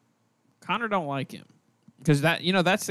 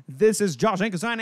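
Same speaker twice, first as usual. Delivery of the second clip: ordinary talk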